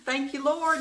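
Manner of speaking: high, loud burst of thanks; telling viewers about her checkout win, high and jubilant; joyful thanks. Retold or imitated. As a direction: cry out thanks to the Lord, thrilled. Relief after big discount.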